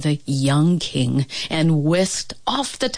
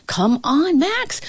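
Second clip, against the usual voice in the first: calling out; 10 semitones higher, slower than usual. Acted. Cook calling dog.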